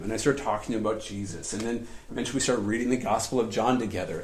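ordinary talk

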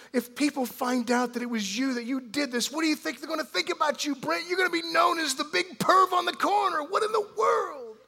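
high, loud, furious scolding of a son; angry parent tirade. Pretend. Pastor playing an outraged father scolding his son, shouting high. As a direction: rail at him like an outraged father. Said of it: "and I'd go".

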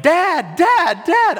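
put-on high, loud child's cry of "Dad"; high childish yell for Dad; kids crying for dad. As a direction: call out like a panicked child.

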